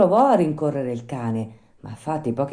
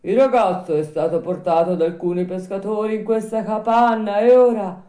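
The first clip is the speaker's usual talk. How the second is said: put-on higher, louder old man's voice; voiced old man, raised pitch; little old man; 8 semitones higher, 6 dB louder than usual. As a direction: tell the news as a village old man. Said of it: "un vecchietto che stava sulla porta".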